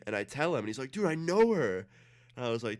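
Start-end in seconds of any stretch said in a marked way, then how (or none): 0.9-1.9 higher, louder imitation of an amazed friend; excited recognition. Friend hears the babysitter's name, excited recognition. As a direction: blurt it out like the excited buddy.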